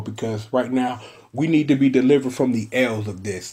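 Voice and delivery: ordinary delivery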